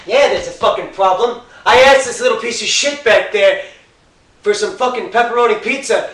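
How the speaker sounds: furious shout at the manager; angry rant. Acted. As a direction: lay into the manager, spitting each curse. Customer complains to manager.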